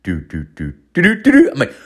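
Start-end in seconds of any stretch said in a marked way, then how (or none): 0.0-0.7 low, soft, slowed heartbeat noise; slowing heartbeat. Retold or imitated. Comic imitating weed slowing his heart, low sluggish thumps. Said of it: "the weed is slowing it down". 0.9-1.5 high, loud, quick heartbeat noise; racing heartbeat. Retold or imitated. Comic imitating his heart speeding up, high racing thumps. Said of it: "my heart trying to speed up".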